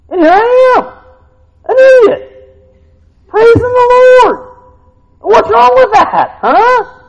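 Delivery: high, loud, exuberant self-mockery; hammed-up joyful praising; excited praise. Believer praising the Lord, loud exuberant retelling.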